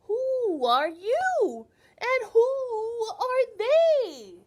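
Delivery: very high, loud, slow excited question; excited children. Acted. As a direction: call out like eager kids.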